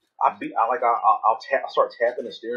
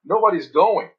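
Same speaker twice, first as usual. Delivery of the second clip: mock red-light rant; 6 semitones higher than usual. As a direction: rant at the empty intersection.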